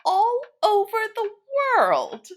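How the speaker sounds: high, slow amazed question; high slow disbelief; incredulous wonder. A narrator's reading. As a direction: marvel at it like a child. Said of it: "incredulous wonder".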